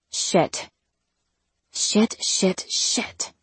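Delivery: hushed, voiceless swearing under the breath; whispered curse. A narrator's reading. Narrator realizes the problem with being seen naked, whispered. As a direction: whisper it as a dawning private dread.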